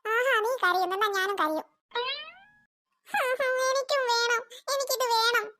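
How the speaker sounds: high kid voice fake-crying for it; whining small child. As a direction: pretend to cry like a spoilt kid. Second child joins crying.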